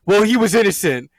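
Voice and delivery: high, loud, fast whiny mimicry; mocking quote. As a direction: parrot the excuse with scorn. Mocking the character's excuse.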